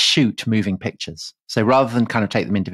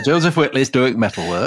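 put-on Yorkshire accent; 2 semitones higher than usual. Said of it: "Do the rest of it in that accent".

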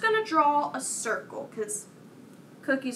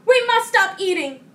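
raised, higher voice of a toad; crying out; 6 semitones higher, 7 dB louder than usual. A narrator's reading. Toad to Frog while still eating cookies, crying out. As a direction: cry it out, louder and higher. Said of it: "cried Toad".

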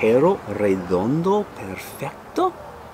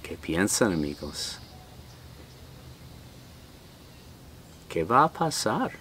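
put-on much softer, lower words to the kids; quiet aside; 4 semitones lower, 11 dB quieter than usual. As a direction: lean in and ask the listeners softly. Reader asks the children directly. (pregunta suave a los niños al final del libro)

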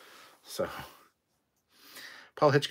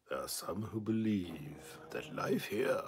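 dramatic opening narration; 2 semitones lower, slower than usual. Acted. Fan reciting a sci-fi show's opening, slow dramatic narration. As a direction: intone it slowly like an epic prologue.

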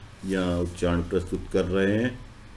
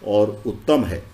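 raised, declaimed reading of sacred verse; devotional scripture reading; 4 semitones higher, 4 dB louder than usual. Acted. Devotee reciting the kavach's blessings to listeners, declaimed scripture reading. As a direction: read it out in measured devotional phrases, projected. Reads scripture translation aloud.

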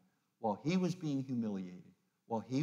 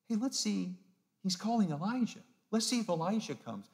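higher sneering jeer at a dying man; mocking taunt; 7 semitones higher than usual. Crowd mocking Jesus on the cross, sneering jeer. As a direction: taunt him, pretending to wait for Elijah. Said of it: "One last mocking".

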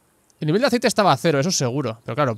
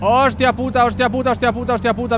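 shocked swearing; 6 semitones higher than usual. Real, not acted. Car just broke down.

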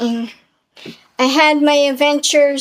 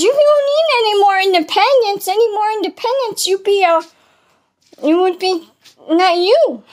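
lightly voiced, higher mock lecture; Mister Wilson lecturing; 7 semitones higher than usual. Mister Wilson telling Susan off, higher mock lecture. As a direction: pitch it up as the lecturing friend. He goes.

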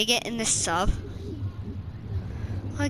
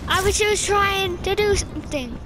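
dramatic doom; 6 semitones higher than usual. Pretend. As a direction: wail like a sinking captain. Vehicle crashing, mock drama.

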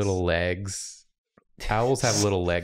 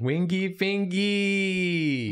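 slow drawn-out singsong; 9 semitones higher, much slower than usual. Acted. Savouring silly word.

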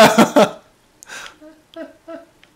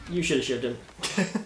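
ordinary delivery

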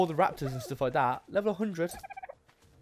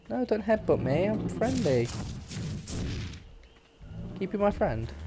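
cooing to a dino; 7 semitones higher than usual. Pretend. Cooing to a wild dinosaur.

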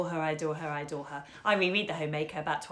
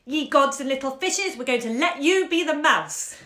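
louder, higher proud announcement; proud excited boy; 7 semitones higher, 9 dB louder than usual. Acted. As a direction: burst out with the big surprise. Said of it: "offered her his best".

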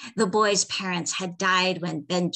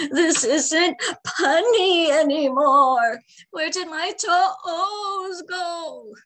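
wailing; 10 semitones higher, 6 dB louder, slower than usual. A narrator's reading. Old man lamenting lost toes.

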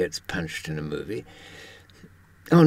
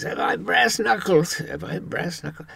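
lightly voiced Daffy, first line pitched higher then fading; a hint of a cartoon duck, eager then trailing off; 8 semitones higher than usual. Quoting Daffy to the audience, lightly voiced duck. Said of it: "Daffy says".

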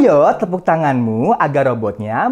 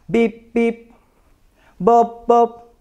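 beeping robot sounds; 3 semitones higher, slower than usual. Shown as a demonstration. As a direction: beep like a toy robot. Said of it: "Bergerak seperti robot".

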